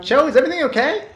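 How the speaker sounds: quick high-pitched concern; high worried voice. Acted.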